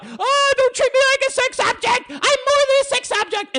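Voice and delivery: high falsetto parody of a woman; mocking woman. Mocking women who refuse to be objectified, high falsetto whine. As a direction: squeak it out like a whiny woman. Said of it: "they don't wanna be objectified".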